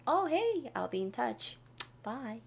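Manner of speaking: casual goodbye. Shown as a demonstration. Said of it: "이런 식으로요".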